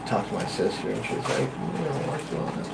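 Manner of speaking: hushed whisper to his sister. Retold or imitated. Kid and sister puzzling over the noise, whispered. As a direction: keep it secret, just breath.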